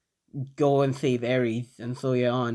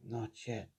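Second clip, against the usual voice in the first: very soft, lower repeat; quiet echo; 4 semitones lower, 17 dB quieter than usual. Acted. Deku repeats the words of the voice in his head, hushed. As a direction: echo it faintly, almost to yourself. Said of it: "Deku just says".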